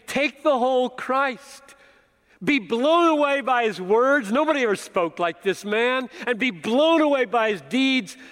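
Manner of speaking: fervent quoted exhortation; preaching exhortation. Acted. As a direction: urge them to be blown away. Voicing Matthew's exhortation.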